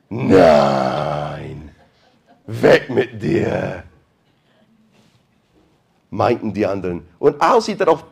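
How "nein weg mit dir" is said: low, drawn-out mocking no; scoffing dismissal. Believers laughing Rhoda off, low and slow. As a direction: brush her off with a laugh.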